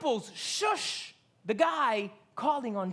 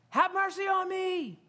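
echoing the cry; 8 semitones higher than usual. Retold or imitated. Quoting the cry again.

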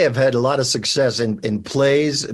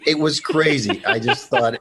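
usual speaking voice

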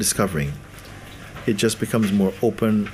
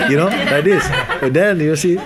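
laughing talk; 7 semitones higher, 5 dB louder than usual. Real, not acted. Laughing through speech.

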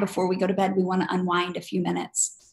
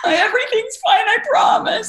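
much higher, louder forced reassurance; panicky flight attendant; 7 dB louder than usual. Retold or imitated. Flight attendant faking calm while panicking, high and loud. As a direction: promise with obvious panic underneath. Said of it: "if she looked at me and she's like".

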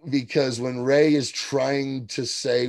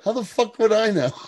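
higher-pitched, exasperated comeback; indignant retort; 8 semitones higher than usual. Acted. Scientist answering a question about forged papers, indignant. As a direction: throw it back, indignant and incredulous.

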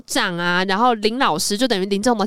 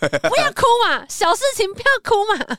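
much higher put-on old principal scolding; fussy principal; 11 semitones higher than usual. Principal scolding the young teachers, higher fussy voice. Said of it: "校长说".

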